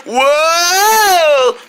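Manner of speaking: ordinary talk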